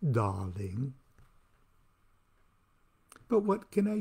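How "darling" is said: low, soft posh British darling. Shown as a demonstration. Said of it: "affected, proper, posh British accent".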